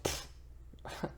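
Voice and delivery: unvoiced dismissive puff; voiceless scoff. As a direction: blow him off with air. Harbard scoffs at Thor.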